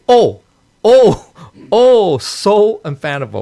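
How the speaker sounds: hammed-up loud, high "oh, oh, oh"; mock exclamation. Teacher mocking a literal translation with overblown awe. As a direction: overdo the awe like a stuffy old translation. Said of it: "like James Legge, he would say".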